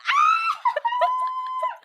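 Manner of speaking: high squealing burst of laughter; shrieking laugh. Real, not acted. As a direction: crack up with a shriek of delight.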